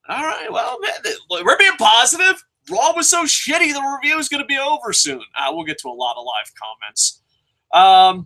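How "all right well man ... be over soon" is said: giddy. Real, not acted. Giddy about short review.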